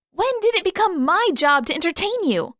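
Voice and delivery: high, loud exasperated demand; angry outburst. Acted. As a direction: throw it back at him furiously. Katara yelling at Sokka.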